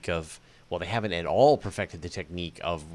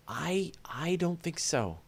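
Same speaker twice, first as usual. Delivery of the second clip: soft whisper, slowly; whispered doubt; 8 semitones higher, 5 dB quieter, slower than usual. Real, not acted. Answering the Mars colonist idea, a slow hushed whisper. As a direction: breathe it out as a hushed no.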